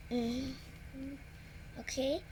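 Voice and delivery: plain reading voice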